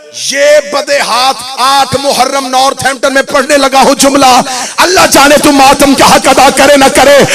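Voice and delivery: loud, high, impassioned recital; full-force preaching. Acted. Preacher in Northampton urging mourners to matam, impassioned. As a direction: build to a crying peak.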